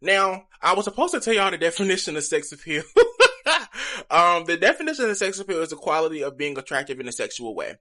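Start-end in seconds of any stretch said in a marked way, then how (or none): 2.9-4.0 sheepish laugh. Real, not acted. Laughs at forgetting the definition.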